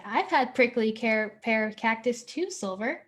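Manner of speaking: ordinary talk, nothing marked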